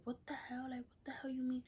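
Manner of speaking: high-pitched mock disbelief; imagined outrage. Family reacting to pregnancy news, mock outrage.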